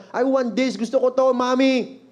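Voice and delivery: mock toddler tantrum; child's tantrum. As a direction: act out a kid demanding from mom. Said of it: "nagtatantrums na".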